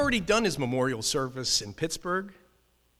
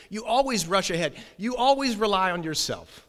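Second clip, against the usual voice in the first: high, loud needling accusation; smart aleck; 5 semitones higher, 6 dB louder than usual. Retold or imitated. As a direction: rub it in with a raised, know-it-all voice. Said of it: "he wasn't saying it the smart aleck way I'm saying".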